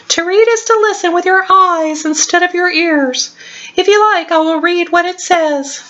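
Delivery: high, squeaky little mouse; tiny mouse voice. Acted. Squeaky the mouse explains reading.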